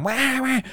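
high, mock baby wail; imitating a crying baby, high. Dad mimicking his crying kid with a high wail. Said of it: "kids were little and they're crying".

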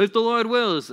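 high, loud pious correction; mock legalist. Legalist correcting someone's smoothie plan, mock officious. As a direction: butt in with hammed-up correction. Legalist tag on school plans.

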